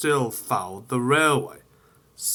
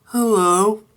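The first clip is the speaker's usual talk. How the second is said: high, louder friendly call; signalman greeting; 11 semitones higher, 8 dB louder than usual. Acted. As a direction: hail the engine cheerily.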